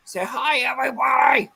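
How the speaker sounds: shouting hello. Real, not acted. Calling out to viewers.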